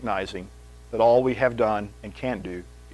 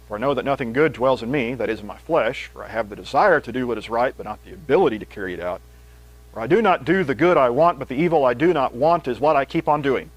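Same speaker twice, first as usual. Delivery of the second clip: raised, louder reading of Paul; scripture reading; 5 dB louder than usual. Acted. Preacher reads Romans to the congregation, fervent. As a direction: read the passage out with fervour.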